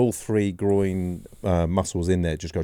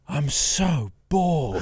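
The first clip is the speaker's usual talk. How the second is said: higher, very slow bored drawl; bored teammate; 9 semitones higher, much slower than usual. Retold or imitated. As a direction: stretch out the boredom mid-match, lightly mocking. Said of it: "He went".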